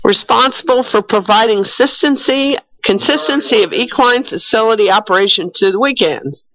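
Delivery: ordinary talk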